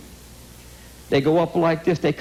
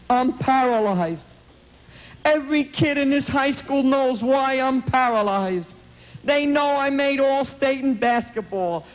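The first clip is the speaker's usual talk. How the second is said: anguished plea; 5 semitones higher than usual. Retold or imitated. Paralyzed boy's plea, retold.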